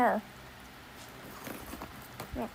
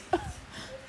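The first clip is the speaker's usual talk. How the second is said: high laugh; 10 dB quieter than usual. Real, not acted. Laughing after the retelling.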